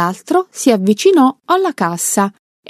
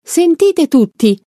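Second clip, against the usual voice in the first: yelling to the room; 8 semitones higher than usual. Acted. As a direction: call out to the whole bank. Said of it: "e urlò".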